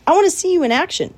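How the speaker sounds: high, loud and fast; coach's call. A narrator's reading. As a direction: urge him on briskly. Charlotte commanding Wilbur.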